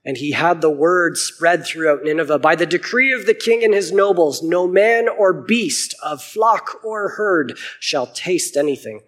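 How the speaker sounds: steady Bible reading voice; clear, measured scripture reading. A narrator's reading. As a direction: read the passage out to the congregation. Reads Book of Jonah aloud.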